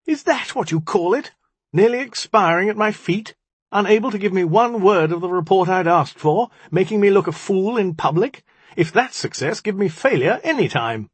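high, indignant tirade; outraged cry. A narrator's reading. Magician berates the djinni for failing him, outraged. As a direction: rail at them, pitched right up. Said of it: "he cried".

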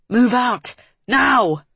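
high, forceful shouted order; screamed command. A narrator's reading. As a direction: yell it as an urgent order in battle. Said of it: "Cedric screamed".

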